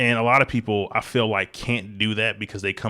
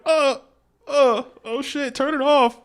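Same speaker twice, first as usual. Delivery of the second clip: high, slow stammering distress; hammed-up teary panic at the screen; mock overwhelmed; 4 dB louder, slower than usual. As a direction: pretend to break down watching. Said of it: "I'm like".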